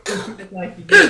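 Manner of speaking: laughing, slow mocking echo. Real, not acted. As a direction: drag it out through a laugh. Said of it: "She did".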